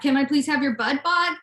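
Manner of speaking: normal talking voice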